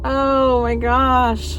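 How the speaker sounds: slow, high, thrilled oh my gosh; delighted gasp. Real, not acted. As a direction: savor it like a dream come true. Gushing over her new haircut.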